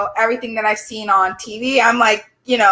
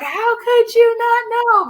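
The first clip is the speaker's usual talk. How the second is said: high, slow, drawn-out mock-mom disbelief; mom's exasperated disbelief; 13 semitones higher, slower than usual. Mom scolding her daughter over the headlights, high and drawn out. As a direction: do mom, stretched up high and incredulous. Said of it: "So mom was like".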